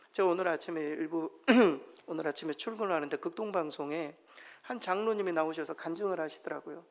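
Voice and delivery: ordinary talk, nothing marked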